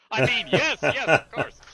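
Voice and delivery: laughing out a loud, high yes. Real, not acted. Guest laughing along with the host's accent joke. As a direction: give in through real laughter.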